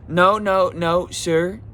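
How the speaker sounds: high, loud, insistent no no sir; mocking the cashier. Retelling to viewers, a high loud cashier stopping him. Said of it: "and she goes".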